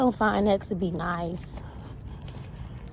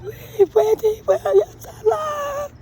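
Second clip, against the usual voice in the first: much higher, slow sobbing shout; tearful viral wail; 5 dB louder than usual. Retold or imitated. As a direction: beg through hammed-up tears. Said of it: "I'm gonna do it".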